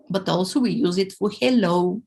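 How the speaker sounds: ordinary talk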